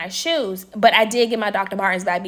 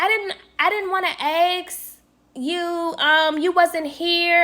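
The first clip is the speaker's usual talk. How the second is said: slow, higher, hesitant imitation of roommate; mock excuse; 8 semitones higher, slower than usual. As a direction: drag out the lame excuse in a higher voice.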